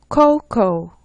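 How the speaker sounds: "코코" said as two syllables, without a final "아"; model pronunciation. Shown as a demonstration. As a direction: model "코코" in English, dropping the ending "아". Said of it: "끝에 오는 아자를 빼시면 돼요". ("코코"를 끝의 '아' 없이 영어식으로)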